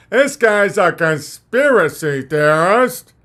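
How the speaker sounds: slow, sneering critic voice; slow mocking sneer. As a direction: drag out the sneer at the conspiracy guy.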